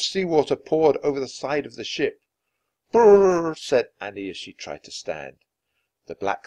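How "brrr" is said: loud, high shivery brrr; shivering brrr. Acted. Annie struggling to stand on the wet ship, shivering.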